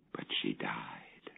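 "But she died," in a slow, breathy whisper. A narrator's reading. Narrator revealing his daughter's death, in a slow whisper. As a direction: drop to a bare whisper for the death. Grim turning point of tale.

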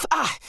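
short sharp yelp of pain; barked yelp. A narrator's reading. Jack yelps at the glowing hot tool. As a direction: bark it as the handle burns. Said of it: "he barked".

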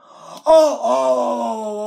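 loud high dramatic wail of oh; hammed-up cries of alarm; mock wail. Kid panics at the computer.

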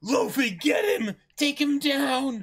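high, loud rallying shout; battle cry. Acted. Crewmate cheering Luffy on in battle, loud shout. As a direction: urge Luffy on at the top of the lungs.

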